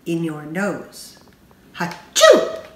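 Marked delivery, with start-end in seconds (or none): 1.8-2.7 big high loud sneeze; acted sneeze. A narrator's reading. Reading the Sneeze poem, a loud high sneeze. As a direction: let the sneeze burst out.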